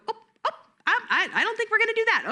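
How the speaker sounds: silly surprised voice. Shown as a demonstration. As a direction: act comically startled at a kid. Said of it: "use a silly voice of surprise".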